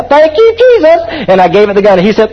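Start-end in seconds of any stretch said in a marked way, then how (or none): 0.0-1.0 excited exclamation. Retold or imitated. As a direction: shout it as you hit the throttle. Pilot gives it the gun, exclaims.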